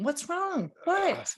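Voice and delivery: high-pitched, exaggerated baffled asking; mock pleading with an upset kid; exasperated questioning. Parent asking an upset child, exaggerated and high. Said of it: "Or you, like, ask him".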